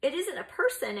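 high, loud insistence; insistent. A narrator's reading. Antonius contradicts Mucius, insistently. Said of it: "Antonius insisted".

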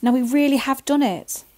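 loud, high-pitched jubilation; triumphant cry. A narrator's reading. Lionel celebrates beating the dragon, triumphant cry. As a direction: crow loudly over the win. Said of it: "he cried".

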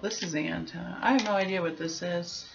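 ordinary talk, nothing marked

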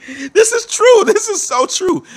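high, loud laughing insistence; can't stop laughing; cracking up while swearing it's true. Real, not acted. Laughing at own story.

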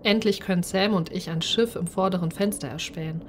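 usual speaking voice

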